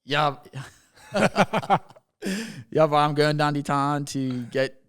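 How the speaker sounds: loud, exaggerated Bermudian island lilt; Bermudian accent. Shown as a demonstration. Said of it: "like, the stereotypical Bermudian accent".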